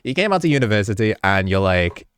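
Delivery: usual speaking voice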